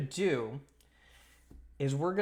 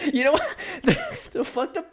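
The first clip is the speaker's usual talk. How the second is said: high bursts of real laughter; laughing; 12 semitones higher, 5 dB louder than usual. Real, not acted. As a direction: crack up mid-sentence. Laughing at chat message.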